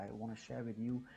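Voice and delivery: ordinary talk, nothing marked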